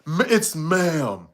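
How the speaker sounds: slow, drawn-out put-on voice; mock stern correction. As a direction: imitate someone insisting, stretching the last word. Imitates someone correcting how addressed.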